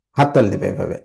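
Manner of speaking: ordinary delivery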